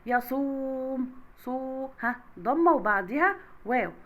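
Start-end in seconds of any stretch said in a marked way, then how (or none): 0.1-1.9 slow يصوم, stretched on the "sū"; long ū vowel. Shown as a demonstration. Teacher showing madd with waw, يصوم with long drawn ū. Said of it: "يصوم مد بالواو".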